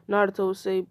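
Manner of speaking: ordinary delivery, nothing marked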